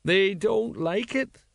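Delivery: high, slow, plaintive echo; mournful agreement. A narrator's reading. Tipsy sergeant agreeing with his mate, mournful. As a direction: draw it out high and woeful. Colon mournfully echoes Nobby.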